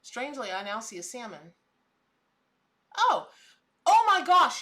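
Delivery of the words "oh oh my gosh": loud, high gasp of amazement; astonished. Real, not acted. Vlogger suddenly seeing an animal shape, astonished. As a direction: burst out as the shape jumps out at you.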